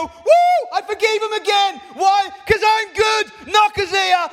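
high, loud gleeful whoop and shout; exultant God. Acted. God delighting in forgiving people again, shouted jubilantly. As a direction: crow triumphantly at full voice, easing slightly at the end. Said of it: "And it makes him go".

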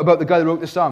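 usual speaking voice

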